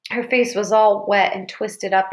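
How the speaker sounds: plain reading voice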